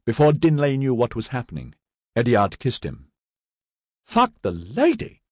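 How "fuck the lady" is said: high, slow startled cry; outraged shriek of surprise; shocked exclamation. A narrator's reading. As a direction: recoil from the kiss with a yelp. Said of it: "Dinlay exclaimed".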